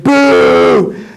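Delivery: long, high-pitched jeer; booing along. Retold or imitated. Spy joining the crowd against Caleb, drawn-out booing. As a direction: hold the boo out, pitched high. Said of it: "I was like".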